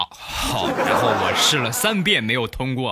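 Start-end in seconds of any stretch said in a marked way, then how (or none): none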